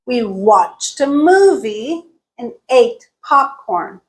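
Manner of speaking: slow, WATCHED, MOVIE, ATE, POPCORN stressed; content words stressed. Shown as a demonstration. Teacher reviewing sentence one, content words stressed. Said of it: "and got reduced".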